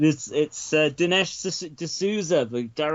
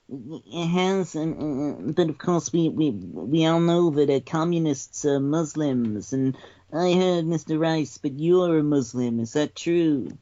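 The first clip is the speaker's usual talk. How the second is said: Dinesh D'Souza impression; 2 semitones higher than usual. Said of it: "Dinesh, does that put your mind at ease?".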